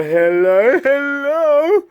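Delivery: hello pitched very high, rising; high squeaky hello. Shown as a demonstration. Testing voice, building higher.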